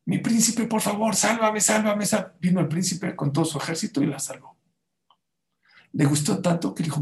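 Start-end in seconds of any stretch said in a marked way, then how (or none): 0.1-2.4 high-pitched mock plea to be saved; a hint of a frightened girl calling for rescue; pleading cry for help. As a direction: cry out to the prince to save her. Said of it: "Empezó a gritar".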